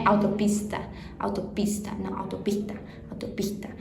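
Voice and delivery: autopista with the s breathed like a soft R; Argentine s before consonant. Shown as a demonstration. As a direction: say autopista letting the s become a rasp. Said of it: "É como se fosse um R". (autopista com o S aspirado, quase um R)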